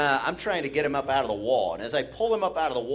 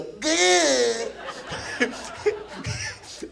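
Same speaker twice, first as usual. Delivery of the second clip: high, drawn-out squeal of pain; imitated high yelp; nose-hit squeal; 12 semitones higher than usual. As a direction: squeal like a kid just hit.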